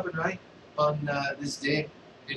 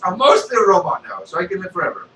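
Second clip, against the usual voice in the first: loud, goofy Vader voice; a loud, silly Sith lord; Darth Vader; 5 semitones higher, 11 dB louder, faster than usual. Acted. Vader answers beggar.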